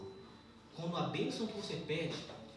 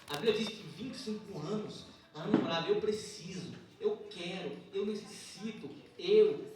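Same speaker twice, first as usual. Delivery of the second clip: raised, louder church preaching voice; urgent, higher-pitched sermon delivery; forceful preaching; 4 semitones higher, 8 dB louder than usual. Real, not acted. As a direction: preach it out to the congregation.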